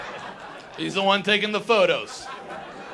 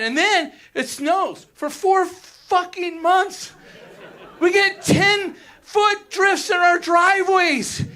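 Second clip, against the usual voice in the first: exasperated; 7 semitones higher, 3 dB louder, slower than usual. Real, not acted. Exasperated story of huge snow.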